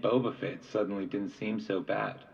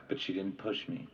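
ordinary delivery

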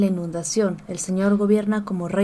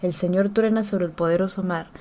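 usual speaking voice

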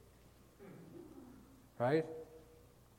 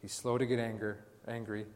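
ordinary delivery

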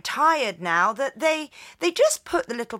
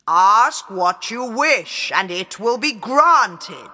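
loud, slow booming voice; booming genie; 8 dB louder than usual. A narrator's reading. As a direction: boom it out like a giant genie. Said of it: "the djinn repeated".

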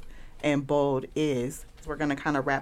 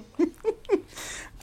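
breathy laugh; 3 dB quieter than usual. Real, not acted.

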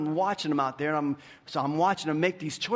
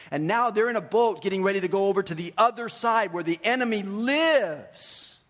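higher, louder, driving sermon voice; preaching at full force; 7 semitones higher, 5 dB louder than usual. Acted. Pastor retelling Luke to the congregation, preaching full force. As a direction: preach it out with rising fire.

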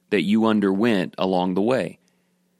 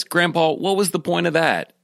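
a lightly voiced boy, higher and puzzled; grandson's voice; 7 semitones higher than usual. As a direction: play the kid, confused and a bit whiny. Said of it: "So he says".

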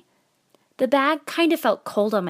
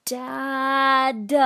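put-on high drawn-out kid whine, final D emphasized; whiny child protesting to a parent; whining crescendo; 7 semitones higher than usual. A narrator's reading. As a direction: swell the word and punch its last consonant. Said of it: "I whined, crescendoing the word".

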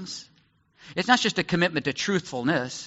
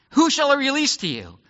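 Pilate asks; 10 semitones higher, 5 dB louder than usual. Retold or imitated. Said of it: "Pilate asks the crowd".

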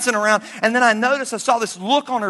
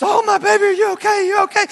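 a hint of a panicked mother, higher; frantic mother; 11 semitones higher than usual. Mother checks on her rescued daughter, lightly voiced panic. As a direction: fuss over her child in mild alarm. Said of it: "She's weeping".

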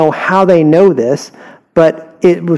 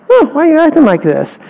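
higher, fast, puzzled comeback; baffled parent; 10 semitones higher, faster than usual. Retold or imitated. Parent baffled by the kid's outburst, higher and quick. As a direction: sound taken aback by the outburst.

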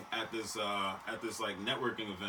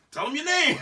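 pitched up and louder, pushing the stranger; urgent aside; 9 dB louder than usual. Retold or imitated. Drunk guy telling the newcomer to give his name, urgent. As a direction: hurry him along. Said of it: "I was like".

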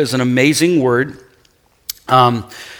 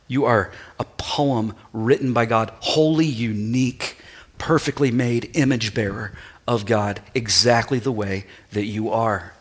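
intimate preaching; 4 semitones lower, 6 dB quieter than usual. Acted.

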